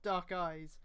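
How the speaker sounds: ordinary talk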